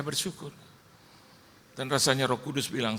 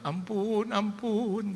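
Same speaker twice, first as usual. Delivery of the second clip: high, drawn-out cry for mercy; imitated plea; 12 semitones higher, much slower than usual. Believer begging the Father for mercy, imitated high plea. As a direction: act out a high, stretched begging call. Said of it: "Begitu Anda".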